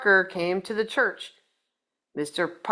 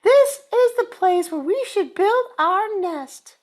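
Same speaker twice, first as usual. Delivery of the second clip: high, louder bird voice; Missus Bird; 10 semitones higher, 5 dB louder than usual. Acted. Missus Bird decides on the nest, high and certain. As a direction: chirp it out high and sure. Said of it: "said Missus Bird".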